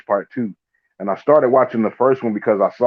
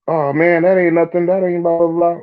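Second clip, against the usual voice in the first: dismissive voice, pitched way up; high mocking voice; 8 semitones higher than usual. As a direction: brush it off, high and sneering. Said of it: "if they come to you talking".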